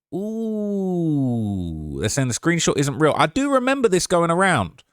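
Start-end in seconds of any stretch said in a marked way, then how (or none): 0.1-2.0 high, sliding drawn-out "ooh"; long "ooh". Real, not acted. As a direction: start high and let it slowly sink. Long reaction while searching.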